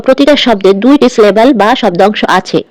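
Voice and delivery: ordinary delivery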